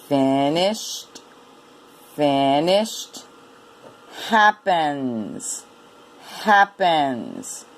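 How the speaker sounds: each word slow, split into two clear syllables; careful word drilling. Shown as a demonstration. Teacher rereading so students don't stumble, careful syllable drilling. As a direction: read each word twice in deliberate beats, like AF-TER. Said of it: "Let's read those again so you don't stumble".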